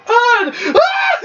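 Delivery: high startled screams into a laugh; genuinely scared shriek; real scream. Real, not acted. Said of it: "And it was real".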